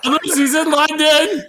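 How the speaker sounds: high wail breaking into laughter; crying with laughter. Real, not acted. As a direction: squeal the words through tearful laughing. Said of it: "this is out of laughter".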